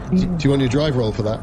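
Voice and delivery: normal talking voice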